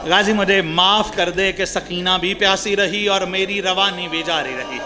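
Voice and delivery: ordinary delivery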